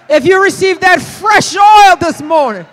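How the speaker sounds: loud, rising call ending in a high whoop; building preacher shout. Real, not acted. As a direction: lift the church to its feet.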